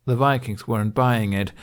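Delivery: plain reading voice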